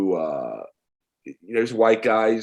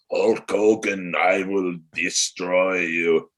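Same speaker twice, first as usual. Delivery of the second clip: slow, heavy fake Russian villain threat; mock Russian accent; slower than usual. Wrestler mocking the Russian heel gimmick in a slow Russian accent. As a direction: growl it out like a cartoon Soviet heel. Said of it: "spoke in that hammer and sickle".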